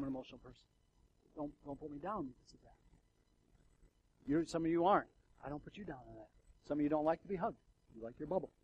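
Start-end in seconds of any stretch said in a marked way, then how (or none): none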